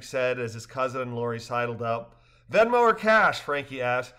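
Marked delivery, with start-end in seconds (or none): 2.5-3.4 higher, louder lively dialogue; cheerful characters. A narrator's reading. Rafters joking after the trip, higher and louder. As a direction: voice the rafters brightly.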